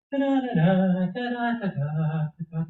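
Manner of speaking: high sung "da-da" piano imitation; imitated piano playing. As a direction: sing out the tiny piano's tune in syllables. Mouse plays tiny piano.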